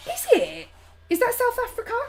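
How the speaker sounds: surprised. Real, not acted. Surprised at cold South Africa.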